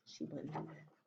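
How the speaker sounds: very soft whisper. A narrator's reading. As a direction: hush it softly. Reassuring whisper.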